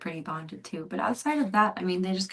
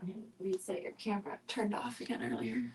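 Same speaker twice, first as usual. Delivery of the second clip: whisper; 11 dB quieter than usual. Real, not acted. Owner explaining the camera, whispered. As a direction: whisper it under your breath.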